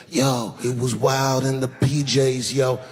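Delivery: slow, lightly voiced street talk; hint of a rapper drawl, unhurried; rapper mock. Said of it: "start talking about the projects".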